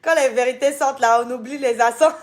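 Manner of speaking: put-on a light, joking Ivorian accent. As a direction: let the Ivorian accent slip out teasingly. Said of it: "accent ivoirien est sorti".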